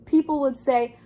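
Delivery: ordinary delivery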